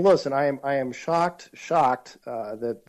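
normal talking voice

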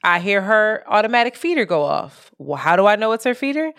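usual speaking voice

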